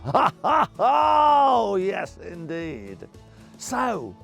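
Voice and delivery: usual speaking voice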